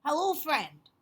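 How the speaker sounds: high, loud friendly dragon voice; Duncan greeting. Acted. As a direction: greet the raccoon brightly. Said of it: "he said to the bull".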